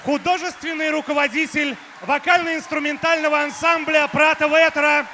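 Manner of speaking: громкое торжественное объявление; подай титул на весь зал; ringmaster. Real, not acted. Объявление звезды под аплодисменты. (громкий голос ведущего)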